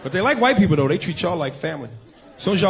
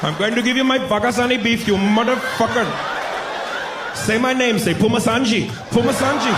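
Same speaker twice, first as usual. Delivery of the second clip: high-pitched, hammed-up East Indian accent; mock East Indian lover, raised and insistent; 6 semitones higher than usual. Indian lover demanding his name be said, East Indian accent. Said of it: "is that East Indian accent".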